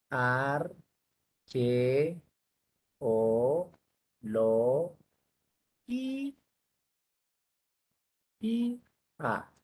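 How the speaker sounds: "arqueología" split into ar, que, o, lo, gí, a with long pauses; syllable by syllable. Shown as a demonstration. Teacher showing where "arqueología" separates, each syllable said alone. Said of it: "que acá se separa".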